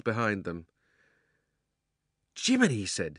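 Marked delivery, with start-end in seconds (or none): 2.4-2.7 high, surprised exclamation; startled boy. A narrator's reading. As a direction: gasp it out like a boy.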